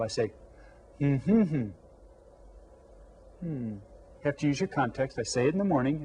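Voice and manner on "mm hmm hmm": hummed greeting. Shown as a demonstration. Teacher hums the morning greeting to the class. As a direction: hum "good morning" as three notes. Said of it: "Every morning I say this".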